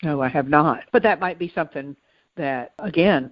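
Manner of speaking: normal talking voice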